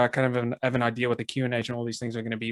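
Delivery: usual speaking voice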